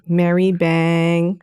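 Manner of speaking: "Mary Bang" drawn out slowly as MAIR-ee BENG; "Mary Bang" at half speed with eh vowels; mispronunciation imitated. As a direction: stretch out "Mary Bang" to show the variant. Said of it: "different ways I've heard it pronounced".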